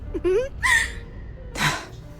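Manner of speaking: shrill gleeful cackle; high villain laugh. Acted. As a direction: laugh with cruel delight.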